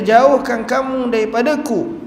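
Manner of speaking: high, slow desperate cry; the weeping man, caught, pleads in fear; frightened plea. Acted. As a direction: beg them to stay away. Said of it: "Dia kata".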